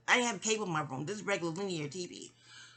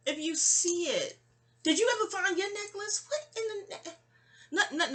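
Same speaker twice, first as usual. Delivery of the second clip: high-pitched asking; 12 semitones higher than usual. Real, not acted. Speaker asks whether the necklace was found, high-pitched.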